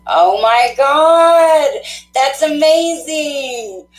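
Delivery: high, slow, drawn-out gasp of wonder; amazed delight. Real, not acted. Psychic hearing her reading came true, stretched out amazed. Reacting to caller's confirmed reading.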